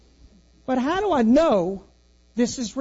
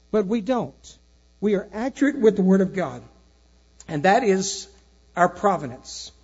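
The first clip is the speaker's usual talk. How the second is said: louder, firm declaration of faith; firm preaching; 4 dB louder than usual. Acted. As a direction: state it as settled truth to the flock. Pastor closes with firm declaration.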